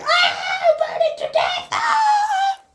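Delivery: high shrieking mock scream of pain; burning scream. Woman thrown in the furnace screams as she burns, high shriek. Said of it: "and she's like".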